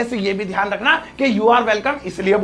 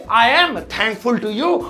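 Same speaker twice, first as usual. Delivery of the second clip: slow, measured English phrase; slow model phrase; much slower than usual. Shown as a demonstration. Teacher introducing a thank-you phrase to learners, slow and measured. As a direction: say it slowly for learners.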